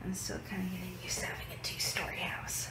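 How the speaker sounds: fast hushed whisper at night. Real, not acted. Vlogger tiptoeing upstairs at night, whispering. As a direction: keep it breathy so nobody wakes. Late night, others asleep.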